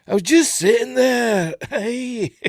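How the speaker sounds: an overdone tearful actor quote; mock teary actor. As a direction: ham up the choked sentiment.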